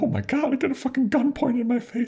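put-on very high, wailing old-lady voice; mock crying. Old lady demon held at gunpoint, mock crying. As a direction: imitate a tearful old lady in a squeal. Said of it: "like, crying".

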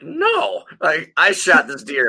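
high, hammed-up protest; imitated possessive buddy objecting; mock indignant refusal. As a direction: refuse in comic outrage, starting very high. Acts out buddy refusing the workers.

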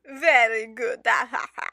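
put-on loud, clumsy Dracula accent and laugh. Co-host copying friend's accent, hammy Dracula laugh. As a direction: copy a Dracula accent, then ham the laugh. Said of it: "I think my laugh is just not".

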